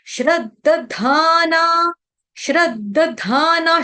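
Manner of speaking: श्रद्धाना said slowly as shrad, full द, dhaa-naa; slow broken-up syllables. Shown as a demonstration. Teacher breaking श्रद्धाना into parts for devotees, slowly. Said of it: "पहले इसको तोड़ लो".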